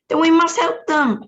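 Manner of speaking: high, loud urgent cry. Acted. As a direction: insist loudly on helping. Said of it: "cried Charlie".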